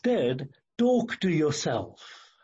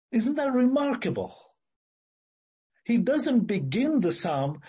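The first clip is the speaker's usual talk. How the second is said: ordinary talk, nothing marked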